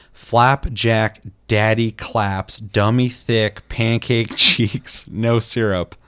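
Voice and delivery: slow, careful reading of a silly name. Acted. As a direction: read it out slowly, savouring every word. Reading an absurd username.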